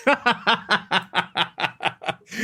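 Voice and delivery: long staccato laugh. Real, not acted. Laughing at his own joke about the movie, long laugh. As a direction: burst out in a long run of ha-has.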